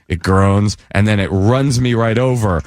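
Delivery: ordinary talk, nothing marked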